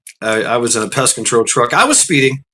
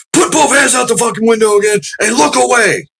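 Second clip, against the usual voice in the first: barked commands; 9 semitones higher than usual. Retold or imitated. Trooper ordering the driver, high barked commands. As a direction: order him sharply.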